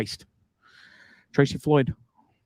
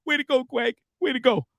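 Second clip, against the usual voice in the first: put-on kids' cheer; 12 semitones higher than usual. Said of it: "As my kids would say".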